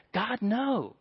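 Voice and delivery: ordinary talk, nothing marked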